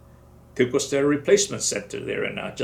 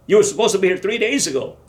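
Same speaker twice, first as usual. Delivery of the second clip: louder, fast scolding; sergeant yelling; 7 semitones higher, 6 dB louder, faster than usual. Retold or imitated. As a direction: rattle it off at him. Sergeant scolding late soldier.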